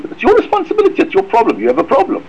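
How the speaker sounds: dismissive, high at the start, then settling; flipping it away. Retold or imitated. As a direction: shove the problem off onto Hashem. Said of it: "Just flip it away and say".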